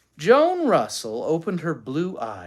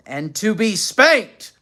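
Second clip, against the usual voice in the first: raised, carrying threat; loud voice; 8 semitones higher, 9 dB louder, slower than usual. A narrator's reading. Father making sure Joan hears, loud. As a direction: call it out so the other room hears. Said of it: "he added in a loud voice".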